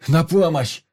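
high, loud yell; yelling for help. A narrator's reading. Vanya holding the doctor back, shouting for help. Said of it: "орал Ваня".

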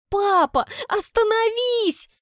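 high, loud cry, slow end; shouting. A narrator's reading. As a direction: cry out to make him stop. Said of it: "выкрикиваю я".

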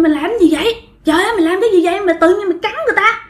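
high, frantic mock yell at a friend; retold yelling. Friend yells at the cousin who bit someone, high mock alarm. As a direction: shout in alarm, pitched high and fast at the end. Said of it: "bạn mới la làng lên".

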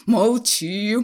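very loud silencing yell; furious shout. A narrator's reading. Husband cutting off his pleading wife, furious shout. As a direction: roar it to cut someone off. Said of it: "крикнул на неё Ширяев".

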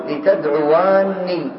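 لتدعواني said slowly with the lam under kasra, "li". Shown as a demonstration. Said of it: "إذا شئت أن تكسرها صارت لام الأمر". (لتدعواني بكسر اللام ببطء)

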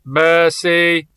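put-on sharp accented command; nervous accented voice. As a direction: snap the order from nowhere. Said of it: "felcsattanó, ideges akcentusú hangra".

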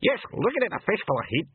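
high, broken pixie brawl; high-pitched dialect rabble in a fight; screaming pixies. A narrator's reading. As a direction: squawk it like tiny men scuffling. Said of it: "and a scream of".

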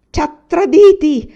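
loud, high outburst of betrayal; furious yell. A narrator's reading. Son learns of the leader's betrayal, yelling furiously. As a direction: yell it out in outrage. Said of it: "urlò".

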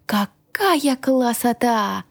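drawn-out admiring exclamation in character; awestruck delight. A narrator's reading. Dragon yells over the wardrobe's contents.

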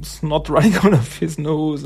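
normal talking voice

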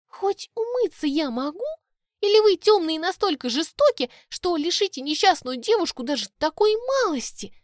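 high, offended fairy plea; cartoon princess. A narrator's reading. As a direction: plead theatrically for pity. Offended Tori pleads.

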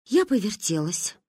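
ordinary delivery, nothing marked

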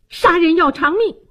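high-pitched furious demand; indignant high outcry; angry roar. Acted. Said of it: "怒吼道".

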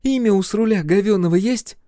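very high, loud female voice; exasperated question. A narrator's reading. Olli demanding the name from the captive, exasperated.

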